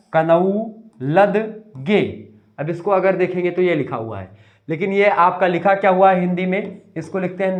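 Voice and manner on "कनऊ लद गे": कनऊ लद गे drawn out slowly, every silent letter sounded; spelling pronunciation. Shown as a demonstration. Teacher mocking the spelling of knowledge with a slow कनऊ लद गे. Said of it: "तो देखिए क्या हो गया?".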